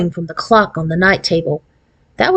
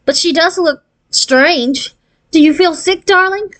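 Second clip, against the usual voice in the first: lightly voiced caring mother; concerned mother; 9 semitones higher than usual. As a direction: ask the child gently if she is ill. Mother worried.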